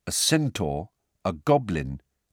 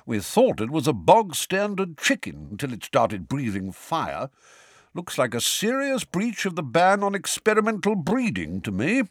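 bearded wizard voice; 8 semitones higher than usual. A narrator's reading. Bob explains the fire-breathing chicken.

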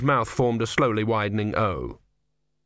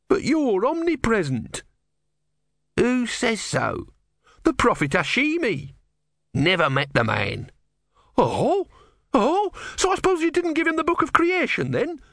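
much higher, quickening accusation; young novice, rising outrage; 13 semitones higher than usual. A narrator's reading. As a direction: pile on the questions in disbelief. Novice voiced by narrator.

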